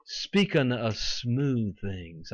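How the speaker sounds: slow, drawn-out coaxing mimicry; mock smooth request. Preacher voicing people asking false prophets for comfort, mock coaxing. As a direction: stretch "smooth" out long and slick. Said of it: "They tell the false prophets".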